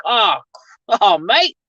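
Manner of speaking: lightly put-on Australian with rising end; mock Australian twang; cliché Aussie accent. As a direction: toss out a stock Aussie greeting. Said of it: "cliche type of shit".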